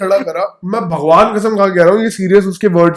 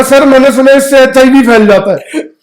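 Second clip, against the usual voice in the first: higher and louder, whiny mock excuse; mock naive excuse; 4 semitones higher, 4 dB louder than usual. A friend mocks his buddy's excuse to a cop, whiny. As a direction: play the scared kid blurting HIV.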